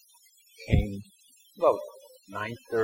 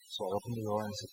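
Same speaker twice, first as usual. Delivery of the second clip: ordinary talk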